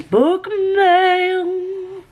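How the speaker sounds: high, sing-song "book mail"; a squeaky delighted reveal; excited announcement. Real, not acted. Vlogger announcing her new parcels, high and delighted.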